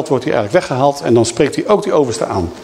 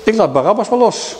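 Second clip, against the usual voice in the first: mock casual Pilate; 8 semitones higher than usual. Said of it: "en zegt".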